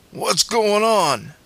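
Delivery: high, drawn-out call. A narrator's reading. Dad coming down the back hallway, calling out.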